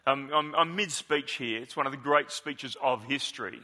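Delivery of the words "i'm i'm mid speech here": normal talking voice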